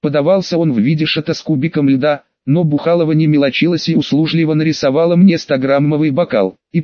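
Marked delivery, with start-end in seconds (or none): none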